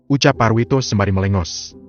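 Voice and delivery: ordinary talk, nothing marked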